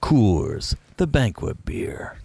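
too-fun take on a gravelly beer ad; playful impression, trailing into breath; Sam Elliott. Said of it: "Nah, it's too fun".

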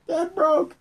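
high mock echo. Echoing in high voice.

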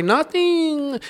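squeaky high innocent "Nothing"; high-pitched mock reply; high mock coworker. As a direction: play it up high and coy. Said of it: "He say".

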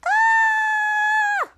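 very high, steady, held "ah"; imitated squeal. Imitating the noise before a thud, high squeal. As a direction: hold one long high note as a sound effect.

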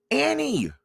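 high, urgent call after someone. A narrator's reading. Jack calls after Annie as she runs off, high urgent call. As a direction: call out the name, high and falling. Calling after runaway sister.

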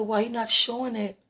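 loud. Retold or imitated. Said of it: "And I said".

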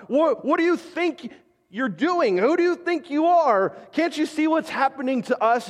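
high, pleading cry of panicked disciples; frantic disciples. Acted. Preacher voicing disciples shouting at sleeping Jesus, high and frantic. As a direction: voice the terrified disciples yelling at Jesus.

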